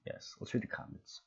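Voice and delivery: soft unvoiced whisper. Real, not acted. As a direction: breathe it as a secret. Whispered aside to viewers.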